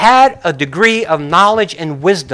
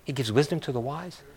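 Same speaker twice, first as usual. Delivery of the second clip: much softer, baffled re-read; soft, confused half-voice; puzzled mutter; 3 semitones lower, 16 dB quieter than usual. Shown as a demonstration. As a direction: mumble it, as if the line makes no sense. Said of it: "That makes no sense".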